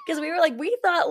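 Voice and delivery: laughing and crying. Real, not acted. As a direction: laugh through tears while you speak. Recalling Mickey moment, overcome.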